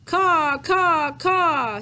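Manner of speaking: high, loud cawing like a crow; crow caws. A narrator's reading. Crow calling over the Lone Pine, loud high caws. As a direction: caw out like a crow overhead. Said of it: "said Blacky the Crow".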